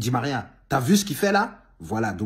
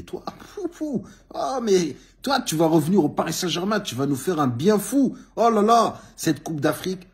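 excited gushing; 9 semitones higher, 5 dB louder than usual. Real, not acted. Gushing at Hakimi's return.

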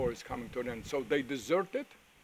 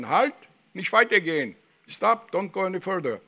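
a patrol's shouted order to halt, higher and louder; retold yell; 4 semitones higher, 7 dB louder than usual. German patrol stopping three approaching boys, loud shouted halt. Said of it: "so he yelled at us in German".

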